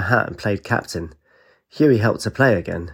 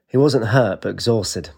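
ordinary delivery, nothing marked

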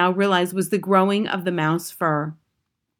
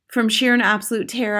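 plain reading voice

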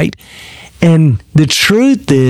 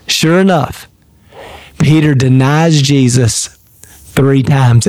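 ordinary talk

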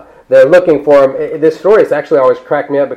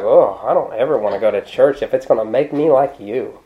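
mock disgust; 4 semitones lower, 5 dB quieter than usual. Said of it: "you're like".

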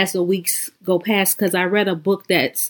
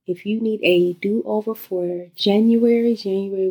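guided meditation. Acted.

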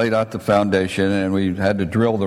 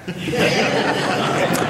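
airy high-pitched giggle; soft unvoiced giggle; breathless high laugh; 11 semitones higher, 4 dB quieter than usual. Real, not acted. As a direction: laugh at your own joke. Laughing at his own story.

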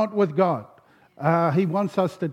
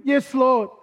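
high-pitched eager acted reply; desperate cry; 7 semitones higher, 3 dB louder than usual. Hiker answers God from the branch, acted desperate cry. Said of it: "In desperation, he cries out".

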